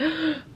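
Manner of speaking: high excited gasp; sharp retold gasp. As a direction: gasp in delight at the dress. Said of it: "she was like".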